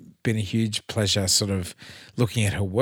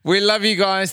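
higher, louder, gushing sign-off; 9 semitones higher, 6 dB louder than usual. Real, not acted. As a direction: belt it out to the listeners. Sign-off, repeated loudly.

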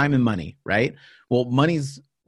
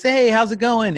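bright, easy hello, much higher; casual friendly greeting; 12 semitones higher, 4 dB louder than usual. Retold or imitated. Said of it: "they come into the office".

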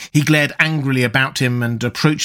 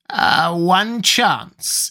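higher, slow, seizing a sudden hope; eager exclamation; 6 semitones higher, much slower than usual. Acted. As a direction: grasp at the one chance. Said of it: "exclaimed Nabonidus".

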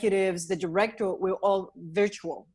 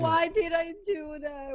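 mock anguish; 8 semitones higher, slower than usual. Real, not acted. Self-reproach after embarrassing mistake.

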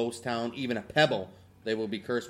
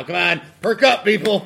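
higher, louder pep-up call; rallying cheer; 8 semitones higher, 11 dB louder than usual. Real, not acted. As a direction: rouse the listeners. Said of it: "rallying the listeners".

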